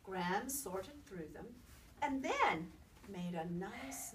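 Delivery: ordinary delivery, nothing marked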